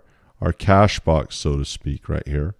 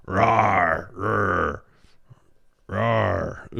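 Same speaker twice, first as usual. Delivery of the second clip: playful little rawr while drawing; mock monster growl; 2 semitones lower, 3 dB quieter, much slower than usual. Teacher drawing the red monster, playful mock growl. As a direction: growl like a cartoon monster, lightly.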